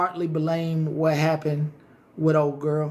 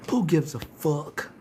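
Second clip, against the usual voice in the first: ordinary talk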